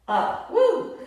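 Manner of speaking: high, breathless laugh through effort. Real, not acted. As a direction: laugh at the burn. Strained laugh mid-exercise.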